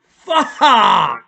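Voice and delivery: loud cursing cry of pain. Retold or imitated. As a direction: curse through the pain. Pain after the yank.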